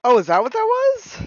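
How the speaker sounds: high, loud surprised question. Real, not acted. Realizing what the noise was.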